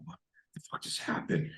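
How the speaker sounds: breathless whisper, almost no voice; stunned whisper. Retold or imitated. Kid alone after the attack, shocked whisper to himself.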